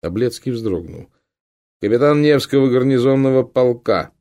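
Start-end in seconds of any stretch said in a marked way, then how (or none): 1.8-4.1 firm, loud officer's call. A narrator's reading. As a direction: announce it loudly and firmly. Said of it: "ответил он как можно громче и твёрже".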